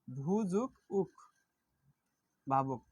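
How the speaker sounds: "ভূ যোগ উক" drawn out slowly, each part apart; slow syllable split. Shown as a demonstration. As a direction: spell out "ভূ যোগ উক" piece by piece, slowly. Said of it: "প্রকৃতিপত্রই করি তাহলে". ("ভূ যোগ উক" ধীরে টেনে ভেঙে বলা)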